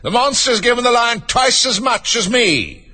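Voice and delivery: high, slow whine of protest; high-pitched, aggrieved unicorn; indignant cry. A narrator's reading. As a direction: complain high and drawn out. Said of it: "cried the unicorn".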